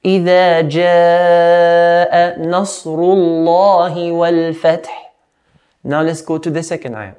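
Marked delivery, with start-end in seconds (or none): 0.0-4.9 measured recitation with long held vowels; Quran recitation. Shown as a demonstration. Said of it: "I'm going to read the whole ayah".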